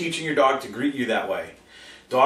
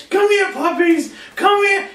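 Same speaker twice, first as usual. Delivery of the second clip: very high, loud, slow calling; excited puppy call; 6 dB louder, slower than usual. Retold or imitated. Owner calling puppies excitedly, very high and loud. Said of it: "And we start".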